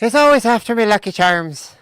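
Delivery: loud, high mock Irish leprechaun accent. Shown as a demonstration. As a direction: belt it out in a squeaky-high Irish voice. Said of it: "can you say that in Irish voice".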